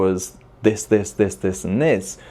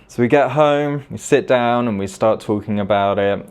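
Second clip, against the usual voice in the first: normal talking voice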